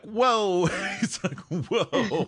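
high, laughing mock whoa; mock surprised whoa. Retold surprised reaction.

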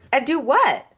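high, baffled question; incredulous. Real, not acted. Podcaster questioning the necklace plan, incredulous. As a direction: throw up the pitch in disbelief.

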